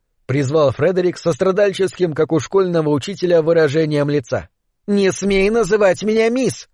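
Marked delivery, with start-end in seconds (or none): 4.9-6.7 exclaiming. A narrator's reading. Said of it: "воскликнула Аполлин".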